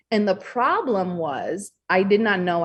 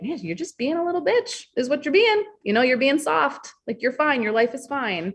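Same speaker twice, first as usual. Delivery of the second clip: higher, scornful self-talk; mock scolding self; 7 semitones higher than usual. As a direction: tell yourself off like a tough critic. Scolding herself inside her head.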